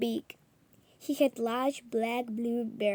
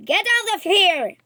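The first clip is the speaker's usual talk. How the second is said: much higher and louder, a raging shout; furious order; 9 semitones higher, 11 dB louder than usual. A narrator's reading. Professor ordering the journalist out, furious shout. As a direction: throw him out with your voice.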